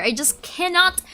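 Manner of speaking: ordinary delivery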